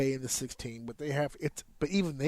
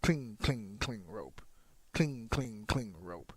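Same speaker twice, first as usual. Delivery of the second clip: lower, softer, spaced-out "cling"s falling to "rope"; slowed-down cadence; 5 semitones lower, 8 dB quieter, slower than usual. Shown as a demonstration. Trainer demonstrating rope-running footsteps, slowed down. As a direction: count out "cling, cling, cling, rope" slowly and evenly. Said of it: "You can slow it down".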